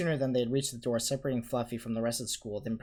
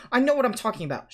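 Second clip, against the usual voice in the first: high, loud curt retort; short; 13 semitones higher, 10 dB louder than usual. A narrator's reading. McGonagall shutting down Harry's protest, short with him. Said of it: "she said shortly".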